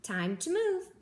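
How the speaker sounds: high, lively little crab; hermit crab voice. A narrator's reading. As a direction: perk up as the crab. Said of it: "said Hermit Crab".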